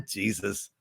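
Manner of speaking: a low, soft groan of a word under the breath; muttered to himself. Real, not acted. Streamer muttering softly after getting the currency wrong. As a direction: mutter it softly, annoyed with yourself. Muttering at his own mistake.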